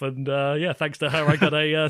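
usual speaking voice

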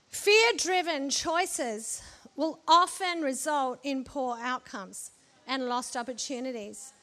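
normal talking voice

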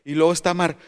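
ordinary talk

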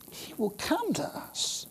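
a slow, breathy hush of promise. Real, not acted. Preacher hushing a promise to the congregation, slow and hushed. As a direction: lean in and hush it to the flock.

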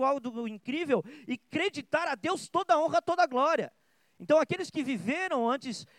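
high-pitched, driving pulpit delivery; impassioned preaching. Real, not acted. Preacher urging the church to live for God's glory, impassioned. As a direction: press each question and answer home with fervour.

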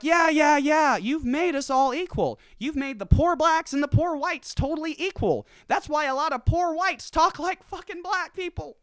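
high sarcastic voice voicing poor whites; high mocking rant. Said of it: "It's like".

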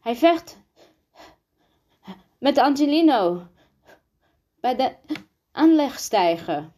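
high, broken up by gasps for air; panting. A narrator's reading. Rosa reporting a fight after running, panting. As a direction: blurt it in short bursts, winded from running. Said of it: "hijgend nog van het wen-, rennen".

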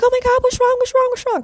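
high, rapid-fire mock panic; panicking mother. Mom answering her crying child's call, mock-panicked. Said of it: "she panics".

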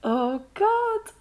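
high, teary sigh of a voice; recovering from real crying; tearful. Real, not acted. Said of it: "I'm actually crying".

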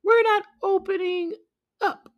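very high, loud squeaky refusal; defiant tiny goat kids; kids crying out. Acted. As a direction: squeal it out like a child. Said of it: "they cried out".

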